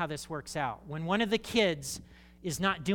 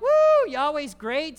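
mock cheer; 9 semitones higher, 13 dB louder, slower than usual.

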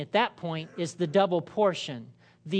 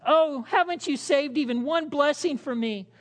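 pleading; 7 semitones higher than usual. Acted. Esau begging his father.

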